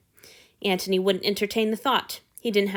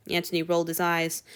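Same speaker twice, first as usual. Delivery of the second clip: plain reading voice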